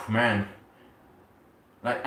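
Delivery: usual speaking voice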